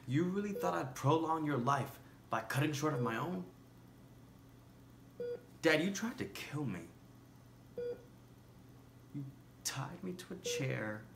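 hurt, indignant reproach; indignant accusation. Acted. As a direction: confront him, voice rising in disbelief. Confronting dying father over past abuse.

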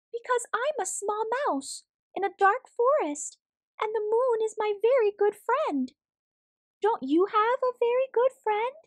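klein muisje, hoog en oprecht; hoge muizenstem. Acted. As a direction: vertel het als een klein muisje. Muis legt uit over de maan.

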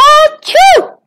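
high, explosive mock sneeze; huge sneeze. Whale tickled by Dennis's fins, huge sneeze. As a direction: burst out a big put-on achoo. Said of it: "The whale gave a huge sneeze".

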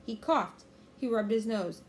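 ordinary delivery, nothing marked

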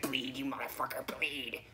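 whispered, willing the player to bleed; hissed urging. Retold or imitated. Whispering at the TV, urging the player to bleed. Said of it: "so I'm like".

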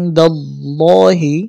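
slow "ইনদাল্লাহি", the "ল্লা" thick and long; heavy Allah. Shown as a demonstration. Teacher shows heavy Allah, "ইনদাল্লাহি" slow and full. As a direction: hum the n, then round out "ল্লা" in "ইনদাল্লাহি". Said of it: "মোটা করে উচ্চারণ করতে হবে".

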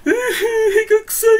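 put-on high, slow whimper of an old man; crying old man. Imagining himself as an old man crying over a fart, high slow whimper. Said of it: "って言って、泣いちゃうんだろうね".

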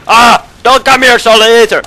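high, loud panicked shriek; screaming in panic at the enemy. Real, not acted. Gamer shrieks at an enemy rushing him, panicked.

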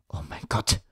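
breathless whispered gasp of fear; horrified whisper. A narrator's reading. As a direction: breathe it out in terror. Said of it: "stöhnte ich auf". (entsetztes Flüstern)

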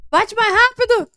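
loud high urgent yells; frantic shouting. Real, not acted. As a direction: yell at them to hurry. Urgent fight against monster.

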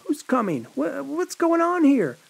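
high, alarmed camper asking; startled camper. A narrator's reading. Camper confronting bloodied Annette, alarmed question. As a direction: stammer it out, startled. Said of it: "one of the campers asked".

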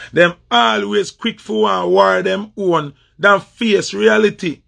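ordinary talk

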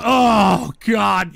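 ordinary delivery

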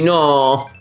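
ordinary talk, nothing marked

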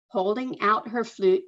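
usual speaking voice

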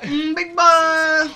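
high, very slow, loud purr; breathy vintage purr. Pretend. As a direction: drag it out like a vamp. Echoes flirt, louder and slower.

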